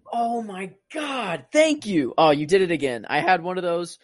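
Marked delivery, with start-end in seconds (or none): 0.1-2.1 real high-pitched, drawn-out relief; relieved gratitude. Real, not acted. Grateful to the co-host for naming the actor, relieved. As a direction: let it burst out high and slow.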